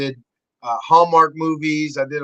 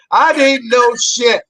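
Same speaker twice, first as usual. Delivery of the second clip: ordinary talk, nothing marked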